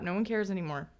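ordinary talk, nothing marked

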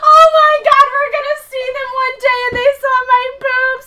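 high, loud panicked squeal; panicked excitement. Retold or imitated. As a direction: squeal in mock horror. Panicked realization, neighbors.

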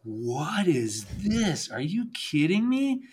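slow, then a high astonished rise; amazed friends. Retold or imitated. Friends handed a sandwich on the chairlift, amazed. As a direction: play the stunned friend on the lift. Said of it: "And they're like".

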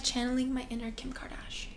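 breathy conspiratorial whisper. Real, not acted. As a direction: whisper it like a secret. Whispered aside.

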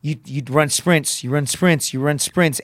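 normal talking voice

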